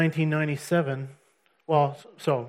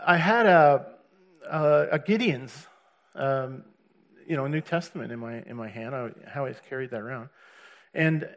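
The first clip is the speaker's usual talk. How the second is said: ordinary talk, nothing marked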